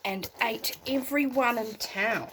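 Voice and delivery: put-on gentle storybook read for a child at bedtime; bedtime story narration. A narrator's reading. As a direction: tell it like a bedtime tale, unhurried.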